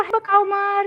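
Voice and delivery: put-on high, childlike model line; prompting line for child. Shown as a demonstration. Mother showing her daughter what to say, high childlike prompt. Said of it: "قولي له".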